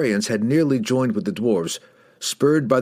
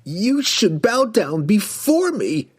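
high, slow, furious villain cry; outraged wizard's imperious screech; Kessel's outraged scream; 12 semitones higher, slower than usual. A narrator's reading. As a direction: demand submission in a high, drawn-out cry. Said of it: "he screamed at Drizzt".

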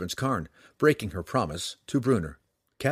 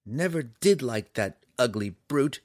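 lightly voiced girl, disdainful; a dismissive sniff in the girl's voice; Canterbury sniffing; 2 semitones higher than usual. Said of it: "Canterbury sniffed".

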